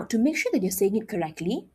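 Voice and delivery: ordinary delivery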